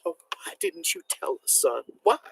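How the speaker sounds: a high, soft, quivering plea from a worried mother; quavering voice. A narrator's reading. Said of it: "in a quavering voice".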